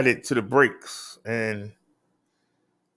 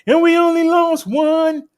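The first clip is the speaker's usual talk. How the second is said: triumphant call; 14 semitones higher, 4 dB louder than usual. Acted. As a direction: crow it out like a victory. Triumphant punchline in stats report.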